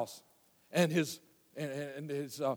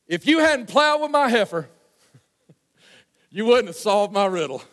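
country preacher voice; 3 semitones higher, 5 dB louder than usual. Retold or imitated. Samson cursing the thirty men, lightly voiced country preacher. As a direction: belt it like a rough country preacher. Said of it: "country bumpkin of a preacher".

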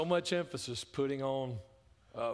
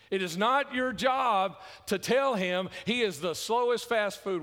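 loud, higher, driving sermon voice; preaching at full force; 6 semitones higher, 5 dB louder than usual. Acted. Preacher warning Christians about rude restaurant behaviour, full force. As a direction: hammer the point at the congregation.

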